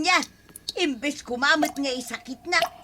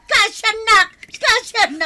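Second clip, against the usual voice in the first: pained cry; 10 semitones higher, 8 dB louder than usual. Acted. Hair pulled in a quarrel.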